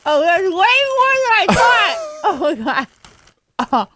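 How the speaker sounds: high, laughing through a stuffed mouth; mouth full of chips. Real, not acted. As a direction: talk around a huge mouthful of chips. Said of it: "Now chew".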